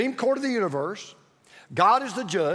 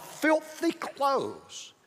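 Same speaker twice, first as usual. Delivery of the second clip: very slow, higher-pitched "Filthy clothes"; drawn-out emphasis; 4 semitones higher, 3 dB quieter, much slower than usual. Real, not acted. Preacher answering "just like what?", slowly drawn out. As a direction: stretch out both words, "Filthy clothes".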